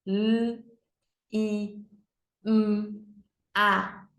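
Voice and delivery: L, I, M, A as "lll, i, mmm, a"; letter sounds. Shown as a demonstration. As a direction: sound out each letter with pauses. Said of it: "Quatro sons". (sonzinho de cada letra: "lll, i, mmm, a")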